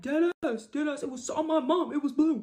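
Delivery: high, loud put-on homeboy swearing it's true; mock homeboy. Homeboy backing up the boyfriend's lie, loud mock homeboy voice. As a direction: ham up the loyal friend vouching. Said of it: "And he'd be like".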